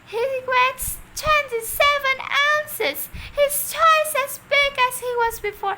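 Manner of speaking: put-on high, thrilled old lady voice; excited Mrs Silver. A narrator's reading. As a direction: squeal the news with delight. Mrs Silver thrilled about tortoise.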